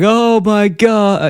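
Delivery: high, slow, drawn-out oh my God; mock Homer reacting with shock; hammed-up cartoon dad voice; Homer's dismay. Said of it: "being like".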